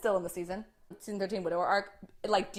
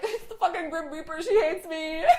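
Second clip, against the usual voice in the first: high-pitched mock exasperation; mock complaint; 8 semitones higher than usual. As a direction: complain in a high, laughing imitation. Acting out Dean's complaint, laughing.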